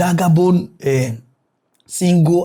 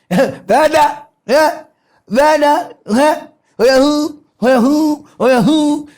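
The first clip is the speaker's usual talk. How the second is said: high, teasing sing-song calls; mock the flirt in a high voice; higher teasing imitation of a gym instructor; mimicking a gym flirt; 8 semitones higher than usual.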